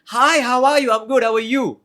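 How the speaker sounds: high, loud, upward-inflected hello; bubbly and eager, every phrase lifted; ultra energetic greeting. Shown as a demonstration. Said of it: "completely approachable, upward inflected".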